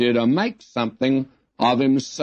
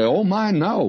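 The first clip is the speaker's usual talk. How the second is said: higher-pitched, imitated polite dismay; mock protest; 9 semitones higher than usual. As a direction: protest politely, pitched well up, as the listeners would. Listeners' protest at his self-mockery, retold.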